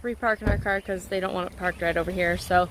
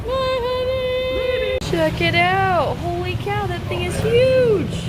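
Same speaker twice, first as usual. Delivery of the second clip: amazed; 10 semitones higher, 5 dB louder, slower than usual. Real, not acted. Amazed at huge bug.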